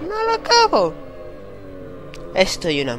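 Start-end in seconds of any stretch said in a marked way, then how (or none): 0.1-0.9 high squeaked-out wail; despairing squeak. Real, not acted. Gamer stuck on the demo, high squeaky whine.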